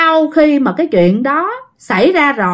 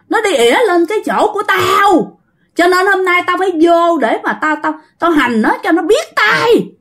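high-pitched, indignant spirit voice; aggrieved, threatening high-pitched complaint; possessed old woman; 7 semitones higher than usual. Acted. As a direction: rant as a spirit cursing someone's wife. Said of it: "bà mới nói một câu vậy nè".

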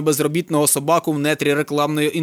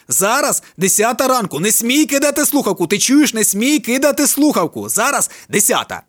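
shouted orders; 6 semitones higher than usual. A narrator's reading. Friends giving orders on phone.

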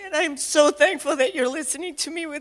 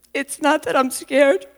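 ordinary talk

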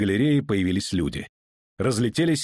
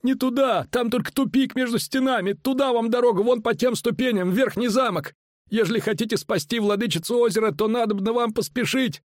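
high-pitched urgent cries; high, insistent shouting voice; shouted warning; 13 semitones higher than usual. A narrator's reading. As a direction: call out directions urgently in a high voice. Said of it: "крикнул Боря, сам не зная".

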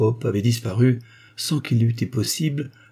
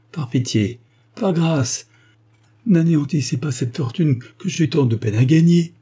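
desperate pleading; 6 semitones higher, 5 dB louder than usual. Acted. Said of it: "en joignant les mains".

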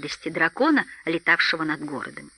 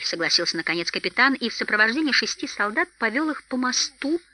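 ordinary delivery, nothing marked

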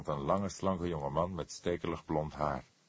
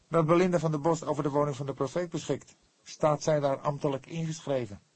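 higher voiced young man, quick; questioning young Fledder, pitched up; young detective voice; 9 semitones higher, 4 dB louder than usual. A narrator's reading. Fledder presses the cook, higher and quick.

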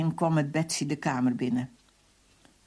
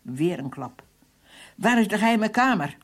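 loud, raised-pitch demand; harsh interrogation; 5 semitones higher, 5 dB louder than usual. Acted. Captain interrogating a prisoner between blows, loud demand. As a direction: fire the questions as a forceful interrogator.

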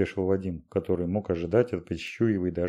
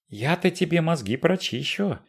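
put-on angry old woman; 9 semitones higher than usual. A narrator's reading.

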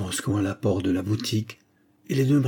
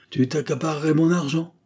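higher ironic question; Dix ironic; 7 semitones higher, 3 dB louder than usual. A narrator's reading. Old client interrupting his greedy lawyer, ironic. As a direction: cut in with mocking disbelief. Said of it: "interrompit Dix avec ironie".

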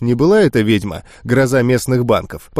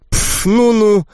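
dismissive snort; 8 semitones higher, slower than usual. Acted. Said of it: "вздёрнула носик волшебница".

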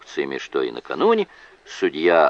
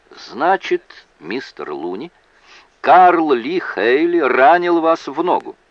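lightly voiced lawyer, higher and louder; courtroom questioning; 9 semitones higher, 5 dB louder than usual. A narrator's reading. Lawyer questioning his witness in court, lightly acted questioning. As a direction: put the question out loud and high, lightly acted.

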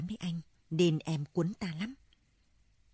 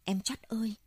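higher sobbing cry of a sister's name; bursting into sobs; 5 semitones higher than usual. Acted. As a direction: cry out for her through tears. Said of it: "Tôi òa lên nức nở".

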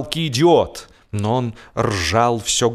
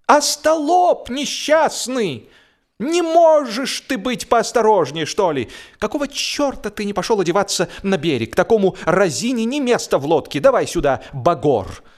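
angry scolding; 10 semitones higher than usual. A narrator's reading. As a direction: rage at a clumsy friend, pitch way up. George furious about his shirt.

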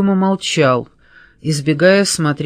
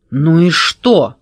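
drawn-out, dogged repeat; slow, stubborn stonewalling; hopeless stubbornness; 4 dB louder, slower than usual. A narrator's reading. Andrei stonewalls the detectives once more, hopelessly stubborn. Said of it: "с безнадёжным упрямством повторил Андрей".

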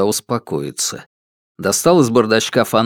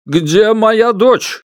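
desperate; 10 semitones higher, 4 dB louder, much slower than usual. Acted. Desperate father on phone.

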